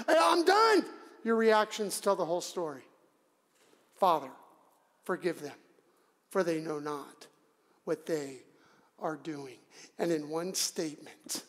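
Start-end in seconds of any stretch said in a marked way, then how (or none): 0.1-0.9 high-pitched mock outburst; exploding. Person whose plan got a kink, acted exploding. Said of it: "all of a sudden we explode". 3.9-9.6 calm forgiveness. Acted. Preacher contrasting Jesus with our yelling, low and calm.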